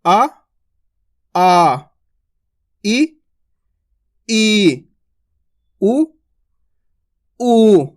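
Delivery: short and long vowels alternated, the long ones stretched; vowel drill. Shown as a demonstration. Teacher leading students through a vowel repetition drill. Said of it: "एक बार repeat करते हैं अपन".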